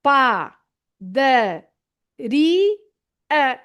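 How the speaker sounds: "pa, da, ri, a" split apart, pitch jumping up on "ri"; syllable by syllable. Shown as a demonstration. Teacher splitting "padaria" for learners, syllables with pauses. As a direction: break "padaria" into beats and lift "ri". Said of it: "you say".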